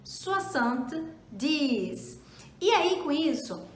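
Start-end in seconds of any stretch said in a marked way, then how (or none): 0.0-2.2 "soixante-dix" drawn out slowly; slow model. Shown as a demonstration. Teacher slowly models seventy for learners. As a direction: stretch "soixante-dix" syllable by syllable. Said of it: "a gente vai falar soixante-dix".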